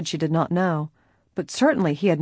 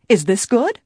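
brisk, louder, raised-pitch question; crisp; 5 semitones higher, 6 dB louder than usual. A narrator's reading. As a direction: ask it crisply and pointedly. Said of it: "she asked crisply".

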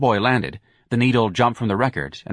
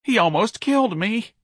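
sobbing; 13 semitones higher than usual. A narrator's reading. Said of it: "the cowboy sobbed".

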